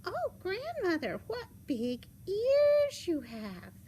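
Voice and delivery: put-on high, drawn-out amazed girl; slow, loud, high wonder. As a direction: marvel slowly in a high child's voice.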